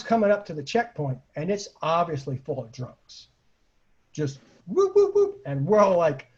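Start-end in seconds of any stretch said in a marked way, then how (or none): 4.1-5.4 whooping siren sound, jumping up high; siren imitation. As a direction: mimic a police siren, climbing into a high whoop. Imitating police siren at checkpoint.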